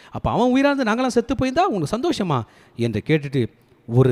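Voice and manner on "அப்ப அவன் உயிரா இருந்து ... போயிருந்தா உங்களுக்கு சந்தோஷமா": fast, high reproachful question; indignant rebuke. Acted. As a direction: throw the question at him in acted indignation. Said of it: "இந்த கண்டிப்பு தேவைப்படுது". (ஆவேசமாகக் கண்டித்துக் கேட்பது போல)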